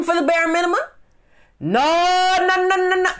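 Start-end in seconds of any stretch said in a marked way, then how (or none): 1.6-3.1 much higher, louder repeated no; emphatic refusal. Real, not acted. Vlogger refusing to keep working for peanuts, emphatic. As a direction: shut it down hard.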